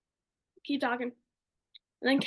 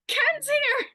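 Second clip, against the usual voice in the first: excited squeal; 12 semitones higher, 4 dB louder than usual. Retold or imitated. Excited retelling of spotting friend.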